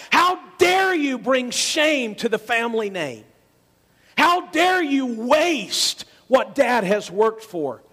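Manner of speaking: slow furious rebuke; indignant older brother. Acted. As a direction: scold the brother for shaming the family. Older brother scolding his brother.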